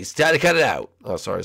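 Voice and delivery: usual speaking voice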